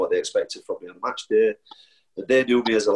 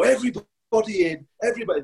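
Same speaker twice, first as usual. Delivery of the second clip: manager calling in; 12 semitones higher, much faster than usual. Retold or imitated. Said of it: "he'd go".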